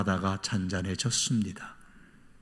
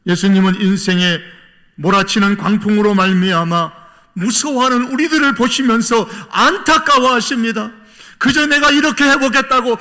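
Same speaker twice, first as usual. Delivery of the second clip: loud, high, urgent pulpit cry; full-force preaching; 13 semitones higher, 10 dB louder than usual. Real, not acted. Preacher on believers who only fear the storm, impassioned preaching. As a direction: drive it home to the flock at full voice.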